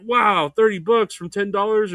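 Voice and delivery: excited. Retold or imitated. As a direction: gush over a small win. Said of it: "I used to get excited".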